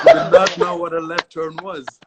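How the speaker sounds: real amused outburst; laughing while talking. Real, not acted. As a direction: laugh through the punchline. Laughing at student's failed turn.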